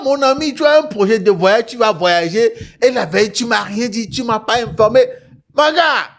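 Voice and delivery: usual speaking voice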